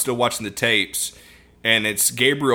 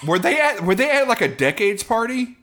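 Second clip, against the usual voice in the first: incredulous; 10 semitones higher than usual. Real, not acted. Amused incredulous question.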